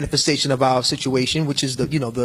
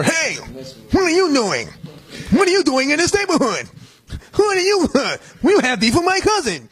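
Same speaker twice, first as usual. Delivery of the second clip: taunting tough guys, pitched way up; mock thug taunting; 10 semitones higher than usual. Thugs confront man in film.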